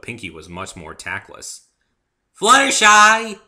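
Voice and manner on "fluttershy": drawn-out, high, very loud calling of a name. A narrator's reading. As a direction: yell the name across the yard. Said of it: "she said quite loudly".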